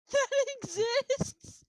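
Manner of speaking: high, loud, drawn-out question; very high mock-amazed cry; incredulous echo. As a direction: squeal it in stretched disbelief. Echoes another player's amazed question.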